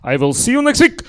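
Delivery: hammed-up Russian father talking into the phone; paranoid dad on phone. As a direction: play the nervous father covering his tracks.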